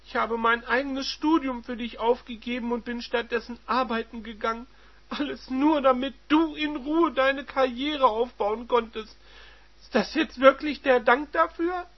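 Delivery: high, loud and indignant; bitter wife. Acted. Wife reproaching her husband, high and loud. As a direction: hurl years of sacrifice at him.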